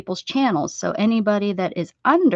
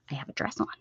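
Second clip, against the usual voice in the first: whispered aside; softly whispered confession; 6 semitones lower, 13 dB quieter, much faster than usual. Real, not acted. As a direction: whisper it like a secret. Confiding aside about no pants.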